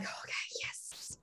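breathy, slow whisper. Retold or imitated. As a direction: whisper it, thrilled and scared. Whispered thrill of being chased.

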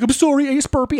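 high, plaintive imitated kid; near-tears apology. Boy apologising to his hero, near tears. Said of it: "almost started crying".

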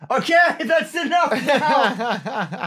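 loud, high, breaking into laughter; laughing it off, slowly. Real, not acted. Laughing at the Alsatian joke.

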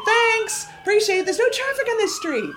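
high, loud mock gratitude; sarcastic shouted thanks. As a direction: yell sarcastic thanks out at someone down the street. Mock-thanking a siren driver outside.

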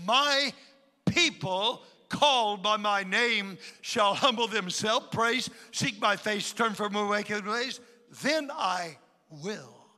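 loud, ringing pulpit proclamation; declaiming scripture as a preacher; preaching at full force. Acted. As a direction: thunder out God's promise to the congregation. Preacher quoting God's promise.

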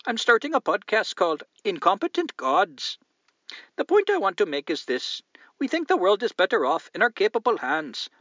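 high, loud put-on Indian accent. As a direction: read the letter in a hammed-up Indian accent, pitched high. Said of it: "try to read it in an Indian accent".

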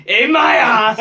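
loud, high punchline yell; cheeky shouted gotcha; mock yell. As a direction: belt out the prank line. Retelling the prank punchline.

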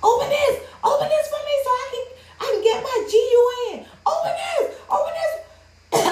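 very high, squealed mock outburst; imitating woman going off. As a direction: imitate her frantic demand way up high. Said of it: "She just started going off".

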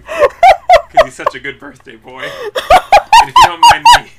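high laughing voice; words squeezed out through laughter. Real, not acted.

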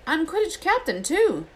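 put-on a boy dazzled by his own reflection; Ron's voice. A narrator's reading. Narrator voices Ron.

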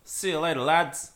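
tentative Irish-accented farewell. Shown as a demonstration. Trying an Irish accent for friends on request, Irish accent. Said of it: "you gotta say an Irish accent".

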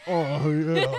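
loud hammed-up moan; mock moan. Shown as a demonstration. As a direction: overdo a fake moan. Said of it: "I verbally do not go".

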